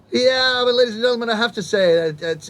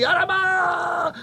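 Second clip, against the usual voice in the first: high, slow shouted amazement; over-the-top shock; 10 semitones higher, much slower than usual. Retold or imitated. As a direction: scream it in mock astonishment. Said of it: "I'd be".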